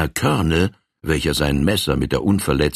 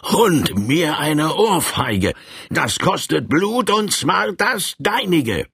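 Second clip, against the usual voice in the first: much higher, slow, raging threat; furious roar; 10 semitones higher, slower than usual. Acted. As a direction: spit out the threat of blood. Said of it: "brüllte in wütendem Tone".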